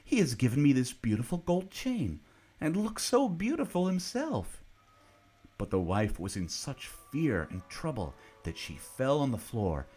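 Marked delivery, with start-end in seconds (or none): 0.0-4.6 higher, louder delighted father; proud excited man. A narrator's reading. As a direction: show off the gift with joy. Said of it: "He went inside and said".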